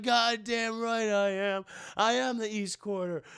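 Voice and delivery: slow, put-on proud growl; boastful thug voice. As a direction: brag about owning the turf. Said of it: "He's like".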